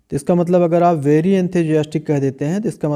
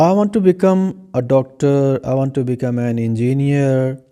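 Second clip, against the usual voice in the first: slow, careful model sentence for students to repeat; much slower than usual. Shown as a demonstration. English teacher reading a model sentence slowly for students. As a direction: say each word slowly and clearly for the class. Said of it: "तो".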